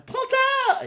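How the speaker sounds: very high, loud shout; battle cry. Acted. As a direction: shout it as you fire. Girl fires laser.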